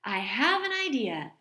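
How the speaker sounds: mother perking up the family; eager idea. A narrator's reading. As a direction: announce the idea with a spark. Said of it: "Henry's mother said".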